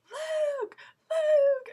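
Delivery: high, loud excited fan yell; retold yelling. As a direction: shriek it to get a star's attention.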